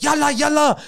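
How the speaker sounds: high, loud call of yalla; shouting in Arabic. Retold or imitated. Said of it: "go like".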